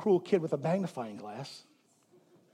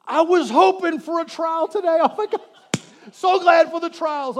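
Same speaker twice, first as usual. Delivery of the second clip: higher, louder, gushing mock delight; mock eager; 7 semitones higher, 6 dB louder than usual. Pastor mocking believers thanking God for trials, gushing sarcasm. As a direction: ham up sarcastic glee about trials. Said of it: "you went".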